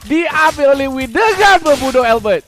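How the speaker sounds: hyped first blood call, high and loud; excited shouting. Real, not acted. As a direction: yell the kill like a live caster. First blood call in live cast.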